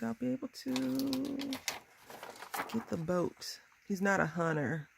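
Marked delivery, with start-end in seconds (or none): none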